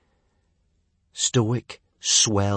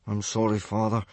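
small, subdued apology; miserable mumble. Acted. Son apologising to his angry father, miserable mumble. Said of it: "Hiccup mumbled miserably".

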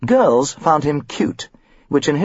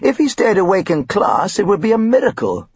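complaining religion teacher; 8 semitones higher than usual. A narrator's reading. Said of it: "the religion teacher had complained".

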